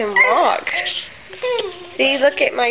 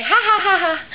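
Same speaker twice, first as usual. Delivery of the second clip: demonstrated laugh; 8 semitones higher than usual. Shown as a demonstration. Mother teaches her baby to laugh, high demo laugh. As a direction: show the baby how to laugh.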